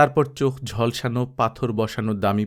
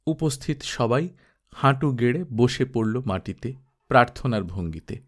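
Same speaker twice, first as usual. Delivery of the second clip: ordinary delivery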